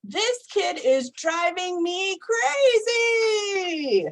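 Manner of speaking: high, slow, frazzled wail; a worn-out mom at the end of her rope; exasperated mother. Retold or imitated. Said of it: "Sometimes his mother would say".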